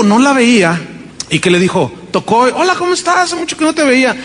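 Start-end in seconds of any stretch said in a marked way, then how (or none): 2.4-4.1 high, bright greeting; cheerful neighbour. Retold or imitated. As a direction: greet an old friend warmly.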